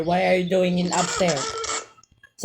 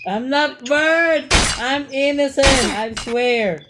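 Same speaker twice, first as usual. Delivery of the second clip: raised, high-pitched pleading; insistent protest; 5 semitones higher than usual. Real, not acted. Accused player insisting to teammates, high protesting voice. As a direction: swear it urgently, pitched up.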